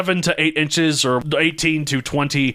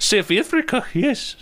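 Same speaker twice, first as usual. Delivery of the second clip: high, badly faked South African; failed South African accent; 11 semitones higher than usual. Host trying the co-host's accent, failed South African. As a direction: have a poor go at South African. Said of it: "I can't do that one".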